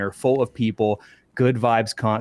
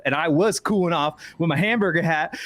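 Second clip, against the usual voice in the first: ordinary talk, nothing marked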